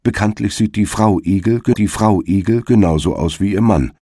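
plain reading voice

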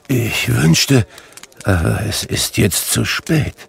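ordinary delivery, nothing marked

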